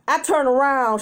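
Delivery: ordinary delivery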